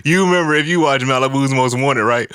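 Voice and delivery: high voice just after laughing. Real, not acted. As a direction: speak while recovering from a laughing fit. Still recovering from laughing hard.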